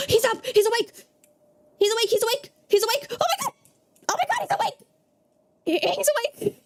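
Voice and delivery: high-pitched ecstatic shrieks; excited shrieking. Real, not acted. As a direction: scream with disbelieving joy. Dog revives, streamer shrieks with joy.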